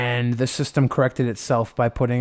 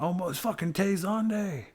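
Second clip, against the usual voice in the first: higher, hushed starstruck fan; breathless awe at a celebrity; starstruck fanboy; 7 semitones higher, 6 dB quieter than usual. Retold or imitated. Friend imitating another fan spotting [name], hushed. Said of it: "And he's like".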